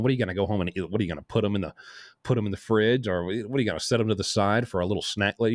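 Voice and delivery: ordinary delivery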